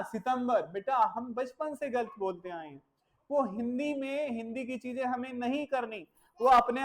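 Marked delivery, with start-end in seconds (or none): 0.0-0.7 सितंबर with "si", not "sep"; wrong Hindi-style pronunciation. Shown as a demonstration. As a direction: say सितंबर as the Hindi month name "sitambar". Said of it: "क्या नहीं बोलना?".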